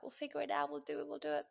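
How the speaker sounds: high-pitched retold resolve; eager reassurance. Couple decide on another ten K, eagerly determined. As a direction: voice lifted, talking yourselves into it.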